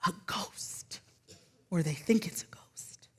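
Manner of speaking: hushed, eerie whisper; spooky whisper. Acted. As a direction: whisper it like a ghost story. Disciples see a ghost in storm.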